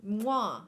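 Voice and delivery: showy "mwah" kiss noise; exaggerated smooch sound; imitated kiss. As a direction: plant a loud mock kiss. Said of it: "he's kinda like".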